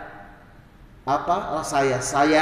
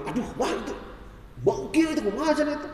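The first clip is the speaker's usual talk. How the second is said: higher, fussy grumble about the food; mock husband's complaint; 7 semitones higher, 6 dB quieter than usual. Husband grumbling to his wife about dinner, mock complaint. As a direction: whine like a picky husband.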